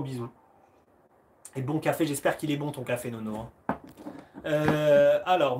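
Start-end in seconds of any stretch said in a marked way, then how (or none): none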